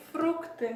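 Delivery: FRUCTE said slowly as Russian "frook-tee"; Russian pronunciation. Shown as a demonstration. Teacher showing learners how Russian fruits is pronounced, Russian pronunciation. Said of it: "voilà comment on prononce ça".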